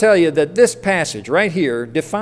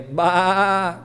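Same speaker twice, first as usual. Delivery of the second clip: sheep baa. Shown as a demonstration. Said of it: "Let's all say".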